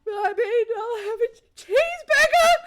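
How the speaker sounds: high, loud, laughing stammer; giddy, climbing to a shriek; flustered giggly squeal. Real, not acted. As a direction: crack up and squeal through the order. Flustered order to her crush.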